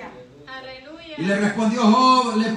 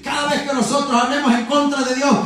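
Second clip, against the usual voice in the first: raised, driving sermon voice; fervent preaching; 4 semitones higher, 5 dB louder than usual. Real, not acted. Preacher condemning words spoken against God, fervent preaching. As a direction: preach it out with rising force.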